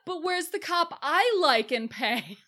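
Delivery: high, whiny clueless voice; mock his stupidity; dumb Nick. Nick asking for his paid-off cop, high and clueless. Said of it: "Just being like".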